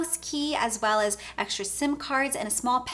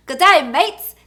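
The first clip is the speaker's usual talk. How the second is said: loud, high mock Australian greeting; hammed-up Aussie accent, big and bright; bad Australian accent; 5 semitones higher, 12 dB louder, slower than usual. Vlogger hamming a bad Australian sign-off, loud. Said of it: "it's a bad accent".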